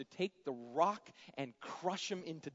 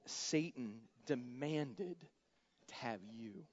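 ominous warning; 3 semitones lower, 5 dB quieter, slower than usual. Retold or imitated. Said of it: "He says, "Behold".

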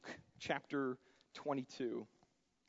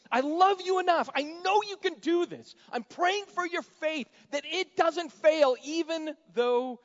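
impassioned cry; 13 semitones higher, 11 dB louder than usual. Retold or imitated. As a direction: cry it out with all your heart. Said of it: "he's like".

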